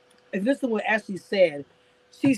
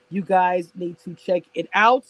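ordinary talk

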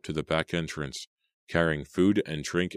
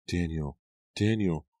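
Nora; 3 semitones higher than usual. A narrator's reading. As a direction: call urgently from a distance. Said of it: "she screamed".

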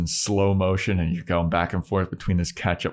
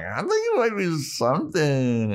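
higher sing-song put-on voice; playful mock gossip; teasing friend; 9 semitones higher than usual. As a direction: tease her in a girly voice. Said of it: "She's like".

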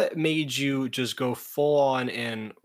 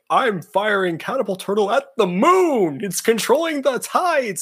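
hammed-up anime villain; 9 semitones higher, 7 dB louder than usual. Host mocking Mako's duel move, hammed-up villain shout. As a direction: announce it like a cartoon duelist. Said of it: "he's like".

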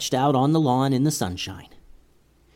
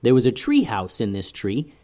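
ordinary delivery, nothing marked

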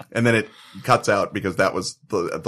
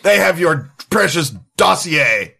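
put-on Slovak accent, higher, building to a shout; angry Slovak man yelling down a recorded line, louder and higher; 7 semitones higher, 5 dB louder than usual. Recorded Slovak man berating the caller, angry Slovak accent shouting. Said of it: "a guy with a Slovak accent".

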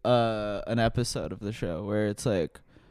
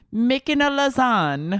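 high leprechaun-like Irish lilt; Irish accent; 11 semitones higher, slower than usual. Acted. Pitching a silly show title, hammed-up Irish accent. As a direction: sing it out in mock-Irish. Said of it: "said that in an Irish accent".

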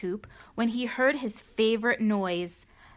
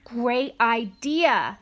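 slow eager exclaim; 4 dB louder, much slower than usual. Acted. Cow excited by idea.

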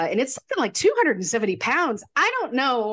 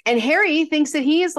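ordinary talk

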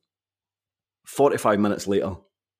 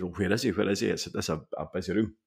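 quiet, irritated muttering to himself; muttering while searching a crowded room; agitated mutter; 7 dB quieter than usual. Retold or imitated. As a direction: grumble it under your breath, annoyed. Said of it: "getting a wee bit agitated".

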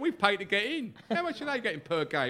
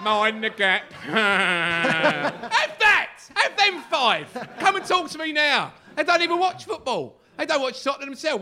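louder, higher jeering chant-like taunts; mock taunting fans; 5 semitones higher, 5 dB louder, slower than usual. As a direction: jeer like a smug fair-weather fan. Imitating taunting fair-weather fans.

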